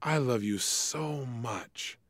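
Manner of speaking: soft, slow, tender "I love you so much"; loving tone. Acted. Auditory lover telling partner, tender and soft. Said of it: "with the most loving tone of voice".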